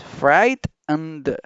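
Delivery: "frightened" very slowly as "frait... n-d"; separated sounds. Shown as a demonstration. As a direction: say "frightened" drawn out, N and D apart. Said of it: "pero es que así es". ("frightened" muy lento, "frait... n-d")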